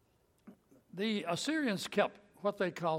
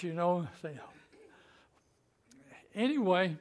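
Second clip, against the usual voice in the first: ordinary talk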